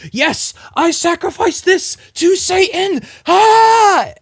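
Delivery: high, slow, loud proclamation ending in a scream; over-the-top satanic yell; hammed-up villain shout. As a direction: bellow it like a cult leader. Cultist shouting at vampire party.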